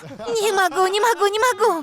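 high, rapidly repeated protest; flustered refusal. Real, not acted. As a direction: beg off in a high, embarrassed voice. Отказывается изобразить акцент на камеру. (смущённый высокий отказ)

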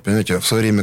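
normal talking voice

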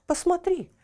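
sharp high cry of look; exclaiming. A narrator's reading. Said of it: "воскликнул человек".

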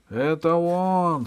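высокий, медленный изумлённый возглас; протяни изумлённое восклицание; протяжный возглас узнавания. A narrator's reading. Камердинер повторяет возглас епископа. (протяжно, высоко и изумлённо)

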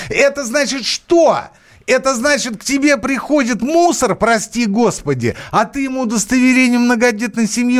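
high, heated sarcastic outburst; heated sarcasm. Real, not acted. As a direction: rant it up high and scornful. Sarcastic rant about police.

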